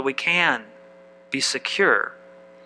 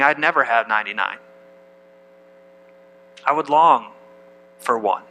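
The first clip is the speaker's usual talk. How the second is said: low, understated wistful voice; longing outcasts; 6 semitones lower than usual. Acted. Preacher imagining the tax collectors' thoughts, low and wistful. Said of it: "the tax collectors and the sinners".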